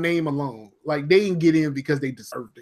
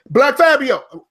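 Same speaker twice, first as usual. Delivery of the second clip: higher, louder call of a name; demonstrated call-out; 7 semitones higher, 5 dB louder, slower than usual. Shown as a demonstration. Showing a friend how to say it, called out. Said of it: "So, this is what you do. You go".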